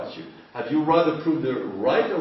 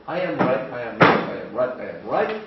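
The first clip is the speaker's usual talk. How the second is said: stubborn insisting; 5 dB louder than usual. Retold or imitated. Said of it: "you don't want to take charge".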